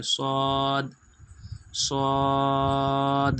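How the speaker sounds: "صاد" with a dark full s and rounded long vowel; heavy emphatic saad. Shown as a demonstration. Teacher explaining tongue position, heavy drawn-out saad. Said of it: "جیب تو دوستو side میں جاتی ہے".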